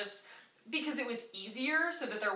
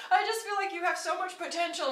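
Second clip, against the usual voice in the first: mock sobbing manager; 8 semitones higher than usual. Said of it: "just starts sobbing".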